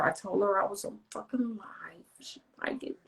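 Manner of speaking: real whispered aside. Real, not acted. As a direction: hush it so the stream won't hear. Secret aside while live.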